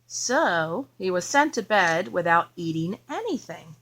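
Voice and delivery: put-on expressive read-aloud for a child; story narration. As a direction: read it like a bedtime picture book. Reading picture book to child.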